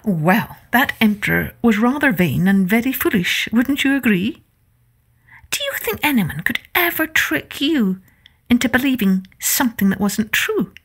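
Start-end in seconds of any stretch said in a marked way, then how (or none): none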